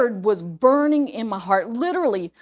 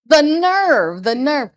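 mock outrage; 5 semitones higher, 5 dB louder, slower than usual.

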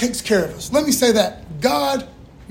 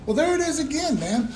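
ordinary delivery

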